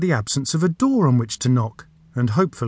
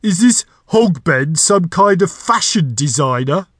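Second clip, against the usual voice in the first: loud, higher, incredulous creature voice; roaring door; 5 semitones higher, 6 dB louder than usual. A narrator's reading. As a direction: demand it loudly as an affronted door. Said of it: "the voice roared".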